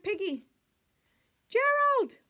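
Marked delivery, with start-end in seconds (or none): 1.5-2.2 high, loud call of a friend's name. Acted. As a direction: shout Gerald's name across the yard. Piggy calls out to Gerald.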